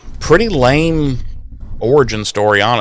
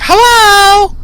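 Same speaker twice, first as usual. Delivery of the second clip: a very high, louder, singsong hello; casual phone hello; 5 dB louder than usual. Retold or imitated. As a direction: answer like you're at home. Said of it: "She's just like".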